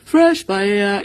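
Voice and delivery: mimicked market call, slow and loud; imitating the vendor. Vlogger copying the paella seller's cry, slow and loud. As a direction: echo the seller's stretched call. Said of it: "Listen to how they announce it".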